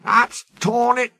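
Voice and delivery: high, exasperated exclamation; dismayed driver. Acted. Driver reacts to broken spring.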